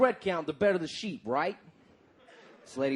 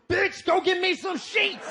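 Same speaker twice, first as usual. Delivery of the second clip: higher, slow, bossy barking from the recliner; lazy husband; 10 semitones higher, 4 dB louder, slower than usual. Retold or imitated. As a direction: order her around like a lazy boor on his couch.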